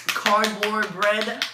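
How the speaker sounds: slow, loud, high and grossed out; disgusted drawl. Acted. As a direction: stretch it out like it tastes awful. Disgusted at lunch food.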